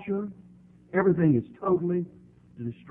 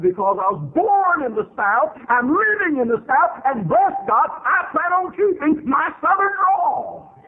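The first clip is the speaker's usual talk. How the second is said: defiant retort; 13 semitones higher, 5 dB louder than usual. Retold or imitated. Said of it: "I said".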